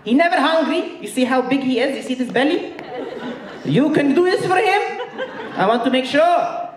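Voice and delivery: put-on high, accented, laughing mother interrogation; Egyptian mom voice. Mom questioning son's girlfriend about cooking, high accented voice. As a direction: grill the girlfriend like a proud Middle Eastern mom.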